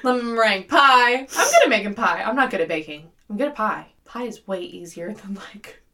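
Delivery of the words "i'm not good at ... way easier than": ordinary delivery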